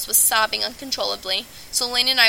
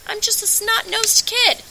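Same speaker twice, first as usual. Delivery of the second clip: put-on lightly raised young boy voice; slow, childlike self-mocking line; kid voice; 11 semitones higher, 4 dB louder, slower than usual. Moon telling the crowd he's just a kid, in a light boyish voice.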